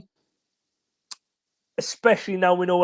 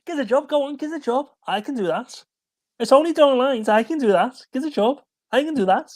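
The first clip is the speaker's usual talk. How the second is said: higher, pleading Scouse voice, begging for work; Scouse accent; 7 semitones higher than usual. Retold or imitated. Host doing a Scouse jobseeker for his co-host, pleading Scouse voice. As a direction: plead for a job in a Liverpool accent. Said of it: "You can't do a Scouse accent".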